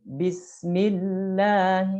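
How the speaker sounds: slow bismillah with a thin, light lam. Shown as a demonstration. Teacher demonstrates lam after kasrah. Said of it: "mimnya kasroh".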